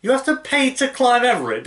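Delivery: high, loud incredulous question; real astonishment. Real, not acted. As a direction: blurt it out in disbelief. Startled reaction to watched episode.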